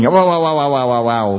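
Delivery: rapid-fire repeated syllable, tailing off; nói hăng quá. Shown as a demonstration. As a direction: rattle it off without a gap. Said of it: "Mình cứ nói hăng quá". (nói dồn dập, liến thoắng)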